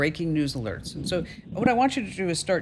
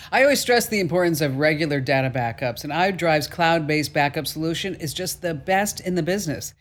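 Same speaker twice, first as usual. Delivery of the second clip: louder, upbeat sponsor read; confident host endorsement, a bit louder; 4 dB louder than usual. Acted. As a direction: sell it like a radio ad.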